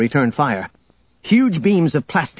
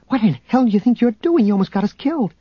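much higher, indignant outburst; alarmed outrage; 10 semitones higher, faster than usual. A narrator's reading. Leia confronting Han after the crash, indignant. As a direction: demand an answer in high-pitched anger.

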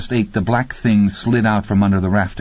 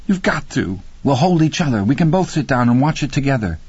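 higher female character voice; acted needy, raised-pitch entreaty; pleading woman; 6 semitones higher than usual. A narrator's reading. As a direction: coax and plead to be held.

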